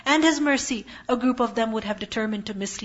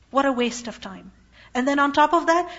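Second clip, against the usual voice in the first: ordinary delivery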